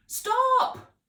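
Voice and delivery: very high, loud shout; shouted stop. Acted. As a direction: cut the noise dead. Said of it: "Miss Potts shouted".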